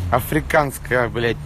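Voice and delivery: normal talking voice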